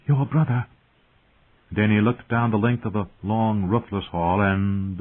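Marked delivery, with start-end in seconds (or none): 0.1-0.7 urgent. A narrator's reading. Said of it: "the handmaid whispered urgently".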